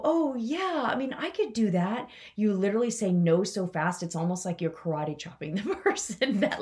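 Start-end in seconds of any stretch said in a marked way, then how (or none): 0.0-2.1 bright, pitched-up mock agreement; lightly voiced eager volunteer; mock eager yes. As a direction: play the pushover who says yes. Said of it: "You don't go".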